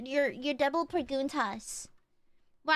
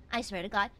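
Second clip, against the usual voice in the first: normal talking voice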